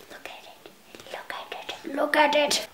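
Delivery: hushed whisper, rising at the end; awed whisper. Real, not acted. Vlogger whispers over irresistible purchase, hushed awe.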